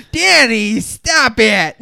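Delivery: high, loud outraged mom; scolding mom. Acted. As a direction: yell like a mom walking in on her son. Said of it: "His, his mom walking in".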